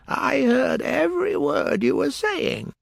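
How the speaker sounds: high, slow, sleepy hoarse voice; hoarse Dormouse. A narrator's reading. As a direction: drag it out like a drowsy dormouse. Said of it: "in a hoarse voice".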